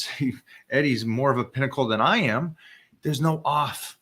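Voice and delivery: normal talking voice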